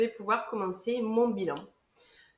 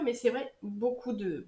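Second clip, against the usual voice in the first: usual speaking voice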